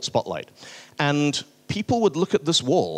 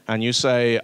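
usual speaking voice

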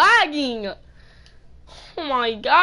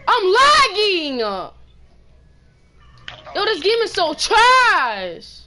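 raging gamer; 3 semitones higher, slower than usual. Real, not acted. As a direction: rage at the lag, drawn out.

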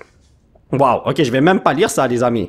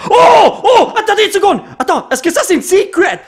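excited; 12 semitones higher than usual. Real, not acted. Spots a secret rare card.

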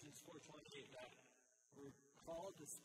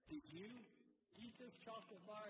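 put-on higher-pitched parent questioning a child; 7 semitones higher than usual. Parent to chocolate-smeared little kid, questioning. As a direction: lightly act out a parent's probing question, pitched up. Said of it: "and the parent asks him".

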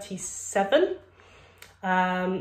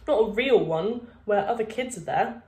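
ordinary delivery

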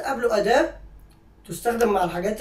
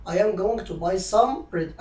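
slow model sentence; much slower than usual. Shown as a demonstration. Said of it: "repeats the model sentence".

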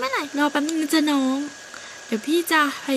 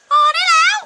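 much higher, loud shout of enough; shout to stop; 8 dB louder than usual. Acted. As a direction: yell to break up the fight. Someone stops the fight. (ตะโกนเสียงสูงดังให้หยุดทะเลาะ)

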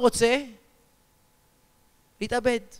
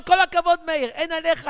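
mock praise; 6 semitones higher than usual. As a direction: cheer him on with gusto. Rabbi loudly praises man wanting suicide.

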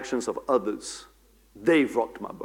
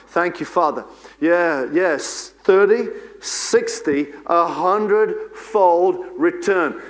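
raised, insistent praying voice; higher-pitched charismatic prayer, building in force; fervent prayer; 4 semitones higher, 4 dB louder than usual. Real, not acted. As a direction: pour out thanks to God with rising fervour. Leads a closing prayer.

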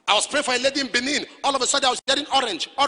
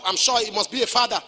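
normal talking voice

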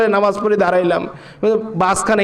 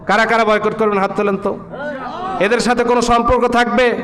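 full-force rhetorical questions, rising in intensity; rally the crowd, each question more insistent; rousing preaching; 6 semitones higher than usual. Real, not acted. Preacher pressing the congregation to cut ties, rousing preaching.

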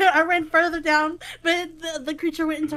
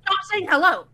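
loud, higher, telling someone off; exasperated shout; 4 semitones higher, 6 dB louder than usual. Real, not acted. Player trying to talk over repeated hellos, exasperated shout. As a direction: snap loudly at a chatty teammate. Scolding teammate who keeps saying hello.

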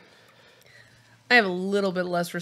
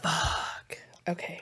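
breathy whisper; 11 dB quieter, much slower than usual. Real, not acted. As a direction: whisper it under your breath. Quiet aftermath of spicy wings.

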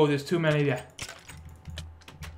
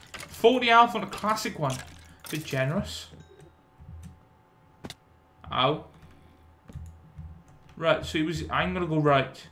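ordinary delivery

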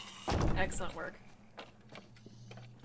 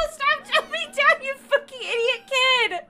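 high, loud scolding shout; real frustrated screech at the game; exasperated yell; 12 semitones higher, 10 dB louder than usual. Real, not acted. Yelling at game kid.

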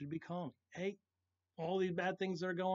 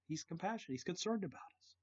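ordinary delivery

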